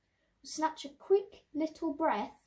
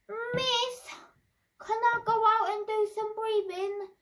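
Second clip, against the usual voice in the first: put-on pleading kid, pitched up; whiny kid; 7 semitones higher than usual. Whiny kid begging the teacher, high. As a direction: whine it like a child begging. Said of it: "this whiny kid going".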